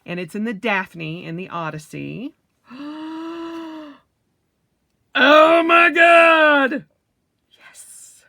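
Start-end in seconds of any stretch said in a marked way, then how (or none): 2.7-3.9 sharp high gasp of delight; breathy gasp of surprise; excited gasp. Real, not acted. Unboxing her orange notebook. 5.1-6.9 much higher, much louder, stretched slowly; drawn-out ecstatic shout; thrilled shout. Real, not acted. Seeing her favorite color. 7.5-8.2 a breathy whispered yes of triumph; hushed little victory yes. Real, not acted. Right after excited shout.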